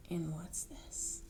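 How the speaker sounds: soft secretive whisper; hushed curious question. Acted. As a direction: lean in and whisper it.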